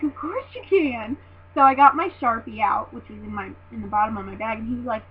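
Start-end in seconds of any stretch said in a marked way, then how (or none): 0.0-1.2 high, drawn-out giddy yes; re-enacted starstruck agreement; eager fangirl. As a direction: gush it slowly, thrilled to be asked. Re-enacts eager yes to autograph request.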